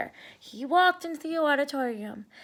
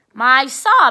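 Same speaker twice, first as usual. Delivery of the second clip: showman; 11 dB louder than usual. Acted. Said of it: "said Arthur".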